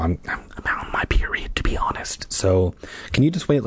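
hushed, halting pretend confession; confiding whisper. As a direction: drop to an embarrassed whisper. Confides an awkward excuse.

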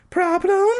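very high squeaky question; high woman voice; Carolyn voice. A narrator's reading. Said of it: "Carolyn asked".

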